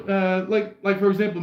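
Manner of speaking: ordinary delivery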